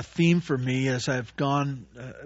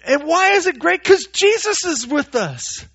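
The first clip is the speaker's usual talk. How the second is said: much higher, louder eager praise; excited disciple; 11 semitones higher, 5 dB louder than usual. Retold or imitated. Disciple boasting about Jesus to friends, much higher and louder. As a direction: gush about Jesus like a starstruck fan.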